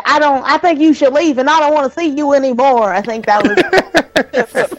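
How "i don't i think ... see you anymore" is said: Ethel Darling impression. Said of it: "How does she talk again?".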